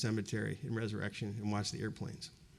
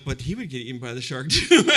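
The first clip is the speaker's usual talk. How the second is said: a louder line breaking into a laugh; laughing through it; 7 dB louder than usual. Retold or imitated. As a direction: laugh at your own silly childhood fear. Laughing at own childhood fear.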